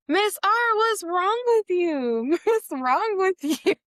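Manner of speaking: high, slow mock kid voice asking what's wrong; playful imitation of confused children; imitating the kids. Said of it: "And they're like".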